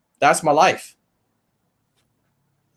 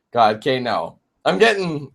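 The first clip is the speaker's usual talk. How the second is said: usual speaking voice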